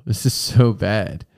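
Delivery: ordinary talk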